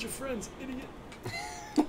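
high, squeaky mock insult; funny high voice. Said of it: "I like that voice saying idiot".

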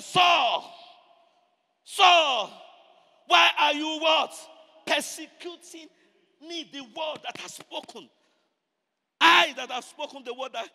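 booming, high-pitched divine call; Jesus calling Saul. Acted. Jesus confronts Saul for persecuting him, booming call. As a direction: call out loud and commanding, stretching the name. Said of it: "Jesus appeared".

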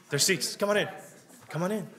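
real welcoming call across a room. Real, not acted. Inviting latecomers to the seats.

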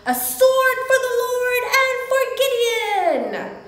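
high, loud rallying war cry; battle shout. Acted. Gideon's army shouting around the Midianite camp, battle shout. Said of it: "They shouted".

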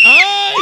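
piercing high, very loud shriek; real scream. Real, not acted. Contestant jolted up to the ceiling, screaming. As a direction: let out a long, full-force scream. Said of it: "¡Qué grito, por Dios!".

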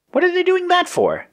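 very high, curious character voice; squeaky-high inquisitive question; interested. A narrator's reading. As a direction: ask it high, with real curiosity. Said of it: "Yellow asked with interest".

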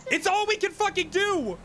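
real exasperated retort, pitched high. Real, not acted. Boss answering a doubting teammate, exasperated. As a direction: snap back in high-pitched frustration.

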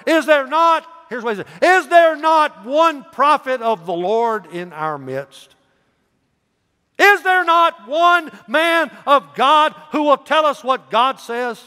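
high, loud, slow pleading cry; pleading outcry. Acted. Jehoshaphat begging the court for a prophet, loud and high. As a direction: call out to the whole court, drawn out.

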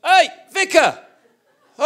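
high, full-volume imitation of a pestering kid; really loud kid. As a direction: bellow it high to get the vicar's attention. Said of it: "I mean, really loud".

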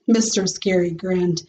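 plain reading voice

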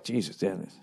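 low, soft muttered curse under the breath; muttered dismay. Retold or imitated. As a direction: mutter it like you just watched a crash. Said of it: "I was just like".

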